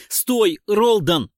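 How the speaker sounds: loud, high cry to stop; urgent shout. A narrator's reading. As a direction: shout to halt someone in time. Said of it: "выкрикнул я". (громкий тревожный выкрик)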